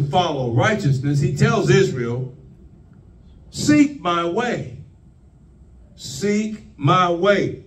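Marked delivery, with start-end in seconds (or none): 3.5-7.6 slow, drawn-out plea; slow pleading repeat. Acted. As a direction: plead it slowly, word by word. God's plea voiced by preacher.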